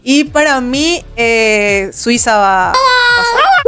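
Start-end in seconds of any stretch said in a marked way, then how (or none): 2.7-3.6 high excited squeal; squealing excitedly. Real, not acted. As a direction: squeal the pick out. Playful group pick, voice jumps.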